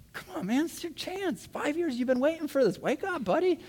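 urging. Retold or imitated. Urging sleeping man to wake.

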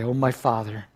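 usual speaking voice

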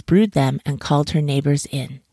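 ordinary delivery

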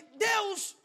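loud, high cry; retold cry to God. Preacher retells seeing his mother weep, loud cry. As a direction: call out urgently to God.

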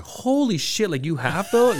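shocked high exclamation; surprised. Retold or imitated. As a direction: blurt it out in disbelief. Friend shocked at seeing item.

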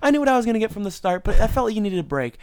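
high, overly nice customer voice; customer service voice. Retold or imitated. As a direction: sweeten it way up like a polite customer. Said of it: "it's like their customer service voice".

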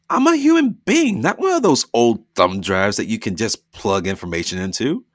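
high, loud burst of protest; woman refusing to be used, loud and high; indignant outburst. Acted. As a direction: shout it as she jumps up. Dina shoots up, protesting.

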